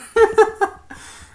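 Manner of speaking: loud, high burst of laughter; high real laugh. Real, not acted. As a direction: crack up again. Laughs at the Grinch line.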